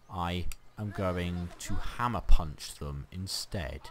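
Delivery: ordinary delivery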